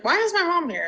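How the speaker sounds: high, alarmed question; sudden worried surprise; mock alarm at mom showing up at work; startled question. Retold alarm on seeing mom.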